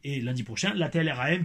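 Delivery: usual speaking voice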